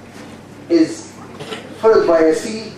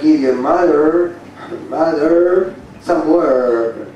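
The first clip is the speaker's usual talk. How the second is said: American accent demo; slower than usual. Shown as a demonstration. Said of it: "In America, we like".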